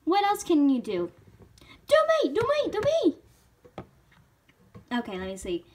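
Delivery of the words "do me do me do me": put-on high, excited squeal of a pleading kid; eager begging. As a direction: beg to be picked, pitched way up. Pony begging to be impersonated next.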